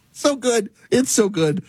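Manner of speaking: high, delighted words through laughter; tickled, laughing. Real, not acted. Host savouring the Aussie voice's quip, tickled and laughing. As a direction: still giggling at the punchline. Cracking up at his own anecdote.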